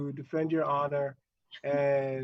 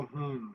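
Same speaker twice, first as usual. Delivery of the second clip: soft low thinking hum; pondering hmm; 5 semitones lower, 8 dB quieter than usual. Retold or imitated. Quoting the catchphrase to guests, soft pondering hum. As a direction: hum as if musing. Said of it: "things that make you go".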